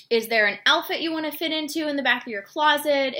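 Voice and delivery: ordinary talk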